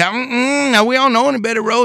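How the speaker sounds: high, quick, loud voice of the neighbors; porch folks voiced. Neighbors on the porch deny knowing Betty, high and fast. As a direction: play the wary neighbor denying it.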